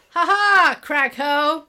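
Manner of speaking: loud, high-pitched gloating cry; theatrical gotcha shout; mock triumphant entrance. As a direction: burst in with a hammed-up aha. Acting gang's triumphant entrance.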